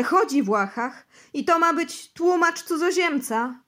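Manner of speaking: loud, high, near-screaming accusation; panicked rant. Acted. Bosy accuses the foreign interpreter, loud and panicked. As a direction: rant about the unclean force in panic. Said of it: "odpowiedział".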